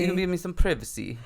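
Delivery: a weak, put-on posh British accent; a hint of an upper-class British accent, clumsily done; bad British accent. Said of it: "You are so bad at accents".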